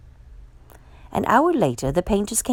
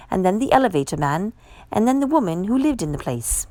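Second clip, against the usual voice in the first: usual speaking voice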